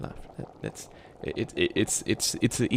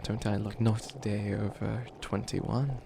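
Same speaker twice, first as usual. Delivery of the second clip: normal talking voice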